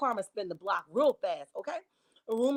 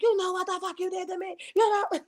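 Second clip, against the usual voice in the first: angry confrontation; 8 semitones higher than usual. Retold or imitated. Wife confronting the husband who had her served, outraged. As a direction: throw the accusation at him.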